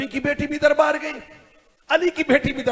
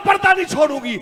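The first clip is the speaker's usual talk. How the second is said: high, wailing refusal; impassioned retold cry; anguished refusal; 5 semitones higher, faster than usual. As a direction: cry it out in desperate defiance. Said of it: "तड़प के कहती है".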